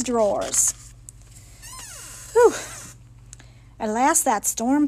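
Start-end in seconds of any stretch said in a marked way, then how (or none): 2.3-2.5 high, quick exhaled whew; relieved whew. A narrator's reading. Narrator relieved once the storm has passed, high whew.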